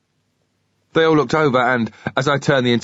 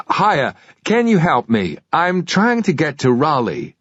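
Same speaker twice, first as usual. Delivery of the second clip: slow, higher put-on American accent; a Brit putting on an American accent to sound lost; friendly American drawl, slightly higher; 5 semitones higher, slower than usual. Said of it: "My American accent was quite good".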